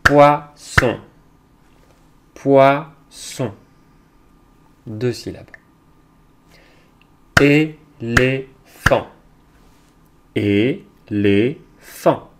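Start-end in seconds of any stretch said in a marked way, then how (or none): 0.1-3.5 Poisson split into poi and sson, loudly; loud, segmented Poisson with a clear gap; syllable by syllable. Shown as a demonstration. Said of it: "Je les fais devant toi". 7.4-12.3 Éléphant very slowly in three beats, é, lé, phant; Éléphant chopped in three punched beats; syllable by syllable. Shown as a demonstration. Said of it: "Je les fais devant toi".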